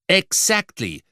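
high-pitched exclamation; calling out. A narrator's reading. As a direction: cry out in emphatic agreement. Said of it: "rief ich". (hoch gerufener Ausruf)

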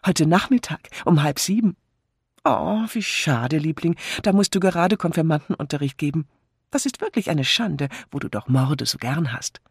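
high, lively wife, playfully regretful; cheery chattering wife. A narrator's reading. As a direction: chatter high and eagerly to your husband. (lebhafte, bedauernde Ehefrau)

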